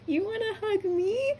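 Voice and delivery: very high squeaky, teary question; tearful disbelief. Retold or imitated. Fan repeating amazement at a hug offer, near tears.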